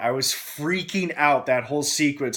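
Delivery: ordinary talk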